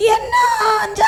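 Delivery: high slow crying call; calling back. Acted. Mother calling back to the girl, slow high wail. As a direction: stretch the answer out as if across a field.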